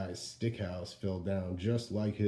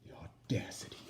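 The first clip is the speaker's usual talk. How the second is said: breathless aside; 4 semitones lower, 8 dB quieter than usual. Acted. Wolf offended aside.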